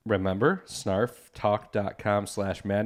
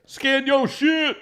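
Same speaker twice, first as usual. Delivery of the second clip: mock shout; 3 dB louder, slower than usual. Cohost mocks the ad read.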